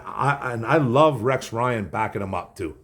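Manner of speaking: ordinary delivery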